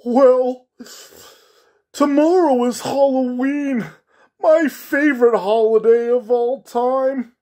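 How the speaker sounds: high, loud, mournful clown voice, slowing at the end; a lonely clown pleading for company, voice pitched high; sad clown voice. Acted. Clown tells the little girl he is lonely, high sad clown voice. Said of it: "said in his clown voice".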